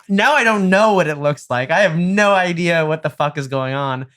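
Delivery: high, loud and incredulous; hammed-up shout of disbelief; mock exasperated yell. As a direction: throw it back at him, outraged. Yelling at dad on phone.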